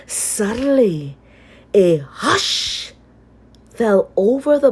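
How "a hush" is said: ordinary delivery, nothing marked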